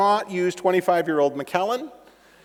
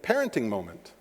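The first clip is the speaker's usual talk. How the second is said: quiet inner thought; 9 semitones lower, 8 dB quieter than usual. Retold or imitated. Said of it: "And I thought".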